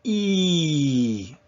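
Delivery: long, loud, held ee; drawn-out teacher's demonstration of E; sustained E. Shown as a demonstration. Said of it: "I want you to say E".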